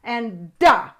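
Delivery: "Duh" with the tongue behind the teeth, pushed out hard; D sound demo. Shown as a demonstration. Teaching kids the D sound, "Duh" pushed hard. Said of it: "Push that sound out real hard".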